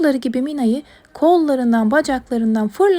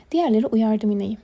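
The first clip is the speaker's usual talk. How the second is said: plain reading voice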